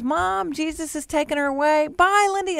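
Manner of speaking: put-on high, excited child voice; retold high-pitched child; little daughter. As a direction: imitate a small girl reporting, then calling goodbye. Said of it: "And she goes".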